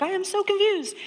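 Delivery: high, bewildered quote of her past self; mock confusion. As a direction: act out being totally baffled. Retold own shock at husband.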